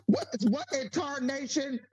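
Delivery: high, put-on Southern old-timer; old prospector. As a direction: cry it out like a cranky old Southerner. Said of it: "Southern piss".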